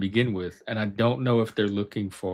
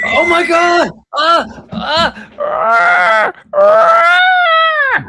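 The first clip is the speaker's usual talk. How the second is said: delighted high shrieks; excited shrieking; 11 dB louder, much slower than usual. Real, not acted. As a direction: squeal with sheer delight. Kid shows dinosaur set, delighted.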